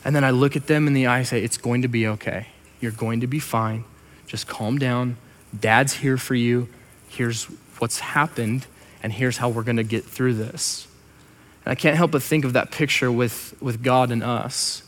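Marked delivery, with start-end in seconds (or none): 1.4-10.9 low, soft comforting voice; gentle, hushed parental reassurance; reassuring dad. Retold or imitated. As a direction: soothe a crying child quietly. Dad reassuring a crying child.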